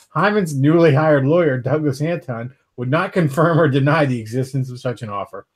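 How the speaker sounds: casual reading of a news story aloud; podcast host reading an article. Acted. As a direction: read the headline and story to the group.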